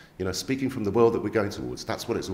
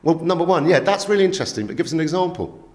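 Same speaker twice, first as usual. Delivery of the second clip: higher, louder skeptical demand; retold group asking for an example, louder; mock challenge; 8 semitones higher, 4 dB louder than usual. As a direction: push back loudly, half-joking. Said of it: "We're going".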